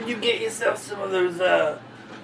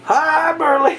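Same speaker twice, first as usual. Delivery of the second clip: cheery greeting; 10 semitones higher, 7 dB louder, slower than usual. Acted.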